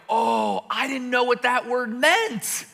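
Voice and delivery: high, slow realisation; a sudden, sheepish oh; sheepish surprise. Retold or imitated. Actor admits he misunderstood, high and sheepish.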